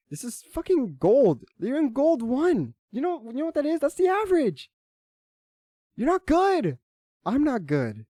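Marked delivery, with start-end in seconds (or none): 0.1-4.7 worked-up telling-off, climbing higher; exasperated rant. Real, not acted. Berating teammate over his rank. 5.9-6.8 high, forceful verdict; heated, high-pitched jab at a teammate; angry put-down. Real, not acted. Berates teammate, then admits same.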